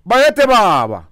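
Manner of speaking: royal salute. Real, not acted.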